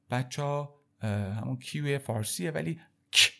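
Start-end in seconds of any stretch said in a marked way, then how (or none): 3.1-3.3 bare consonant click from the throat; throaty French k burst. Shown as a demonstration. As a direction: release a lone k sound, no vowel. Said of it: "صدای همچین صدایی داره". (صدای ک از ته حلق)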